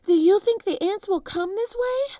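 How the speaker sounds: out of breath from running; panting. A narrator's reading.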